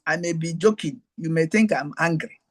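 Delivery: normal talking voice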